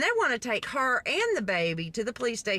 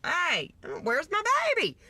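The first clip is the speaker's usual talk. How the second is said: high mock cry, no real volume; mock frantic mother; 7 semitones higher than usual. Imagined mother searching for her child, half-hearted mock scream. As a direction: imitate a mother calling out. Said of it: "screaming, 'Where's my baby?".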